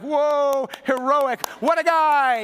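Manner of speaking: hammed-up cheer for the hero; mock admiration. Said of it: "all the guys are like".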